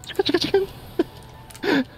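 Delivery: high, squeaky clucking on the word; very high chicken imitation; chicken noises. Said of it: "I hear chicken noises".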